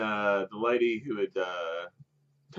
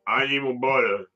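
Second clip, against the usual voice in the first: muffled and louder, as if through a double chin; 6 dB louder than usual. Retold or imitated. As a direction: smother the words while demanding butter. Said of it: "But kinda muffled 'cause there's, like, this chin".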